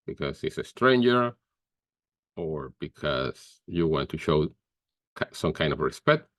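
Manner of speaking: ordinary delivery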